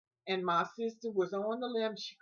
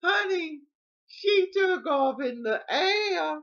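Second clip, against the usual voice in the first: high, loud, drawn-out storyteller's cry; big high-pitched comic emphasis; amused exclaiming; 10 semitones higher, 8 dB louder, slower than usual. Real, not acted. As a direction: holler it in delighted disbelief. Exclaims over comic climax.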